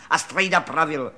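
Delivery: ordinary delivery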